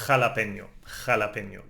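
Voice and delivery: Jalapeño with a scraped throat sound on the j; Jalapeño like xa-la-PEH-nyo; Spanish jota. Shown as a demonstration. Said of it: "You are scraping the throat".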